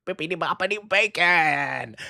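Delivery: high sing-song comic voice; playful high bippity boppity bacon; Jim Gaffigan bit. Retold or imitated. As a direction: chant it like a silly spell. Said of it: "Jim Gaffigan is the artist who loves bacon".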